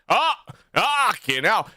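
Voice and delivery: high, drawn-out "fucking hell". Real, not acted. Drunk host noticing the slipping diction, drawn-out high "fucking hell". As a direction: stretch "fucking hell" slowly, pitched right up. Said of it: "I'm actually pronouncing the S".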